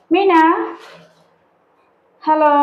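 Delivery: calling a pet. Real, not acted. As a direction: call out to get a pet's attention. Calling to her pet bird.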